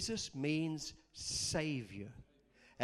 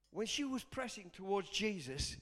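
ordinary delivery

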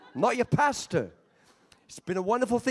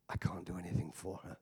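whispered, much softer and lower; hushed confession; 14 semitones lower, 11 dB quieter than usual. Retold or imitated. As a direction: breathe the words out, almost gone. Psychiatrist confesses his hopelessness.

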